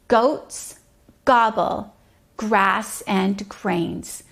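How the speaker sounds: very slow, word by word; deliberate teaching read. Shown as a demonstration. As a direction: spell it out slowly for a child. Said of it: "repeat slowly".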